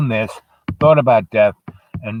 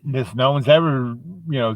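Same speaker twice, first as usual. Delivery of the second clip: ordinary delivery, nothing marked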